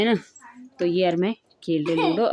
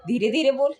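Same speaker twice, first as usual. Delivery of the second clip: louder, higher teasing repeat of his brother; mocking echo; 5 semitones higher, 4 dB louder than usual. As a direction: parrot the scolding back to mock it. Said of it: "छोटा वाला बोला".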